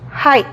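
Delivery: "Height" dicho /hait/ sin g; silent gh. Shown as a demonstration. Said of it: "la G no se pronuncia". (height con gh muda)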